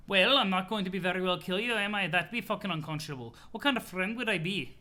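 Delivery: mock-reasonable troll in a silly accent. CA denying he'd kill a friend, protesting accented voice. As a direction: protest in a put-on character accent. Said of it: "laughing at the C A voice".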